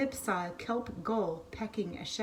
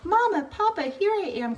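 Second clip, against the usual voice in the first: much higher and louder, joyful cry; cried out; 10 semitones higher, 7 dB louder than usual. Acted. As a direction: shout to your parents in relief. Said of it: "cried Pip".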